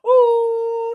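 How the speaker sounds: high, loud drawn-out howl of a name; mock howling shout; wolf-like howl. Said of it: "they shout like this".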